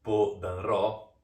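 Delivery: Podanró stretched slowly into Po-dan-ró; Podanró said slowly, each syllable set apart; made-up reading sounded out. Shown as a demonstration. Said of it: "R se lê Podanró".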